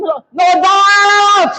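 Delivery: high, long-drawn shout on DOUBT. Real, not acted. Preacher showing the congregation how to release it, drawn-out shout. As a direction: shout and stretch the last word out slowly. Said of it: "Isigaw mo kasi yung doubt".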